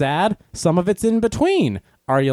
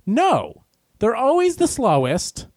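ordinary delivery

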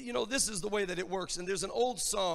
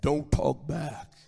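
low, slow baritone voice; deep baritone; 6 semitones lower, slower than usual. Acted. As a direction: drop down into a deep, drawn-out dad voice. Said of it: "deep baritone voice say".

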